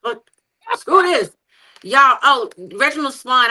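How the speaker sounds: usual speaking voice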